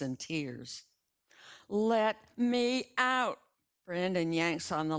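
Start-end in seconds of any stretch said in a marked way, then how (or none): 1.7-3.4 high, loud, slow plea to be let out; desperate demand. A narrator's reading. Brandon trapped in the crashed car, loud slow demand. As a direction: drag it out, trapped and frantic.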